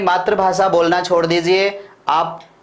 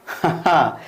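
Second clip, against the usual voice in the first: brief chuckle at own joke; a quick, real laugh; short laugh. Real, not acted. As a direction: laugh lightly after joking. Laughs at his own recharge joke.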